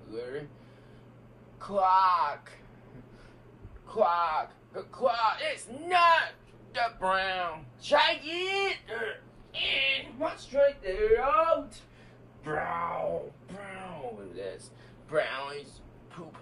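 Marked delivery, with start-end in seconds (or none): 4.7-11.7 high, loud host verdict; game-show host call. Pretend. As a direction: shout it like a quiz host. Kid acts as a game-show host.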